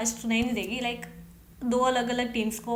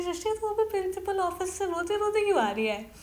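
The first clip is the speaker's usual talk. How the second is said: curious classmates; 7 semitones higher than usual. Retold or imitated. Classmates' question, voiced.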